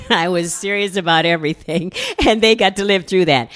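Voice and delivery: laughing while speaking. Real, not acted. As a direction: let the laugh colour the words.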